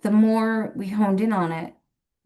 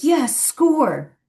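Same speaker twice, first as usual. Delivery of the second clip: higher-pitched mock cheer; retold triumph; 8 semitones higher than usual. Siblings cheering a correct guess, retold triumph. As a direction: celebrate a scored point, re-enacted.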